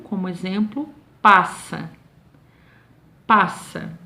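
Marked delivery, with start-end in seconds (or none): none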